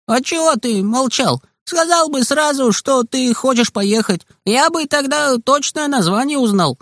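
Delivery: put-on high, far kid voice turning on his friend; Kostik's voice. A narrator's reading. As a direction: squeak back the reproach. Kostik's voice, scolding back.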